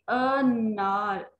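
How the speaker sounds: अनार with a short first अ, slowly drawn out; short a in anar. Shown as a demonstration. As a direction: say अनार with a crisp short अ at the start, stretched slow. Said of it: "आप लफ्ज पे गौर करें". (अनार, पहला अ छोटा, धीरे खींचकर)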